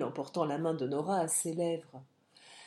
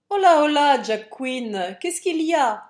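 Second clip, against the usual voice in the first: loud, high hail on arrival; hearty shouted greeting and question; calling out; 7 semitones higher, 11 dB louder than usual. A narrator's reading. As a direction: call out to find out what's happening.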